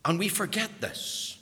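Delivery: ordinary talk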